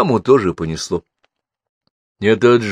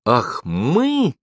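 higher-pitched mock-outraged exclamation; a mother's raised-pitch exclamation; exclaiming; 5 semitones higher than usual. A narrator's reading. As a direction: pounce on it as the mother. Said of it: "воскликнула мама".

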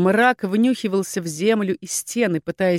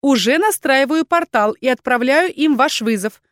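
higher, louder assistant's report; efficient, raised-pitch acknowledgement; reporting for duty; 6 semitones higher, 5 dB louder than usual. A narrator's reading. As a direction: report back promptly and clearly. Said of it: "отрапортовала Атланта".

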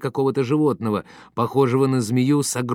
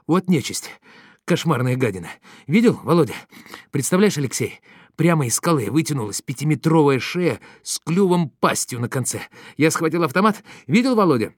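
agitated, breathless account; 6 semitones higher, 6 dB louder than usual. A narrator's reading. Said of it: "сбивчиво рассказывал, поминутно озираясь".

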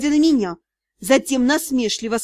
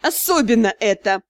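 irritated; 4 semitones lower than usual. Acted. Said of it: "раздражённо согласился свекромонстр".

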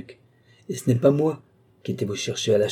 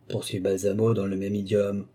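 plain reading voice

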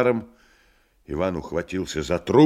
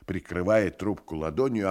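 ordinary delivery, nothing marked

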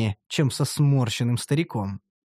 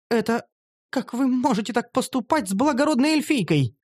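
higher, shocked noble elf; indignant elf girl; 8 semitones higher than usual. Acted. Noble elf girl protests being made a tank, higher and shocked. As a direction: protest wide-eyed. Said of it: "Раскрыла она глаза".

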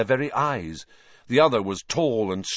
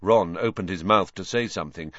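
ordinary delivery, nothing marked

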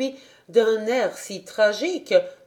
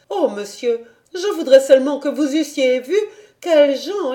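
higher, louder, faster complaint; outraged governess; 6 semitones higher, 5 dB louder than usual. A narrator's reading. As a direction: raise pitch, scandalised.

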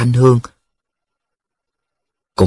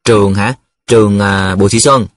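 hesitant, halting answer with a filler; stall, fumbling for an answer; stammering evasively. Acted. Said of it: "Mẫn ấp úng". (ấp úng, ngập ngừng)

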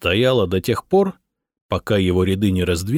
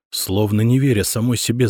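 plain reading voice

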